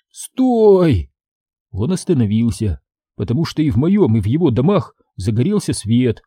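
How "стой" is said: high, drawn-out strained plea; choked rasp. A narrator's reading. Boy pinned under the moose, choked rasp. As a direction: beg breathlessly for it to stop. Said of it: "задыхаясь, прохрипел я".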